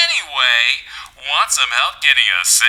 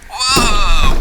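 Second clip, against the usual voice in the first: startled shout; 5 semitones higher, 7 dB louder than usual. Acted. AI startled by breaking glass.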